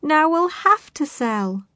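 high, slow, despairing wail; sobbed. A narrator's reading. Farmer's wife faces selling up, sobbing high. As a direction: draw it out in a high lament. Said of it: "sobbed Missus Hill".

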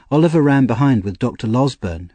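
usual speaking voice

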